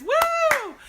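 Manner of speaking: high, loud "woo"; piercing excited cheer; celebratory whoop. Real, not acted. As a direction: whoop it up for a friend. Cheering Eric on.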